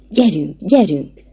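slow, drawn-out urging; insistent plea to go. A narrator's reading. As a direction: press them to come along. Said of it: "sürgeti Gyula".

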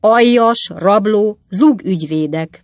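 loud, word-by-word name-calling; shouted insults. A narrator's reading. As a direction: hurl each insult separately. Pickwick carries on shouting insults.

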